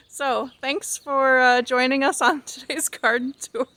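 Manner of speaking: giggling through the words; trying not to laugh. Real, not acted. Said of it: "Sorry, we've got giggles".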